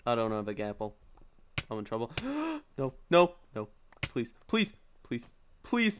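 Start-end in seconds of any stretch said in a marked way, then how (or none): 2.2-2.7 sudden high-pitched gasp of alarm; startled gasp; sharp high gasp. Real, not acted. Realises trouble in game. 2.8-6.0 high, urgent begging in short bursts; nervous gamer begging for luck; anxious pleading. Real, not acted. In trouble in game, begging.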